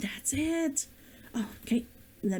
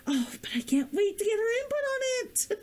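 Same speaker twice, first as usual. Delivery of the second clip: excited; 9 semitones higher, faster than usual. Real, not acted. Excited about beta reader.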